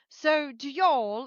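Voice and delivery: slow, drawn-out mock Southern question; mock Southern y'all. A London guy teasing her with a slow Southern y'all. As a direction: stretch out a fake drawl. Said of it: "he was like".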